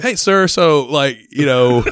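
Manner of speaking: high, loud reporter voice through laughter; mock reporter interrupting. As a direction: cut in like the flustered reporter. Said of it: "the lady stops".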